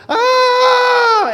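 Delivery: high shouted cry of panic; panicked scream. Retold or imitated. Preacher acting out the disciples screaming in panic. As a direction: scream like a terrified disciple in the storm. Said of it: "the disciples are here going".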